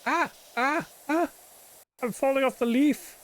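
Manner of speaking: high-pitched yelps of mock alarm; playful panic. Player falling off a leaf in game, squealing mock panic.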